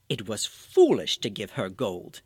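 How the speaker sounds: a hint of a pompous mayor; thinking to himself. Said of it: "he thought".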